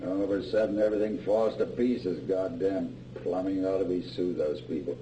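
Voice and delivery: very soft, low muttered complaint; weary grumbling. Acted. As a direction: grumble half to yourself. Tired father grumbling about plumbing.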